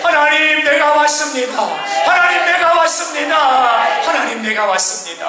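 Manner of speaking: high wailing call to God, easing at the end; crying out in prayer. Acted. Preacher acting the king's desperate prayer, crying out. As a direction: cry to God with all your voice. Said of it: "울부짖으며 기도했다는 거예요".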